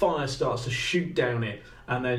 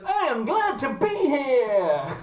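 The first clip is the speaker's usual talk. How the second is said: very high, slow robot dog voice; high-pitched, over-keen robot pup; Buddy; 11 semitones higher, 4 dB louder, slower than usual. Acted. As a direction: announce it eagerly in a stretched robotic squeal. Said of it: "But he goes".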